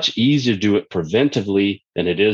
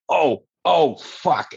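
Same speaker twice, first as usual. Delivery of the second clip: mock pain; 6 semitones higher, much slower than usual. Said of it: "your client's like".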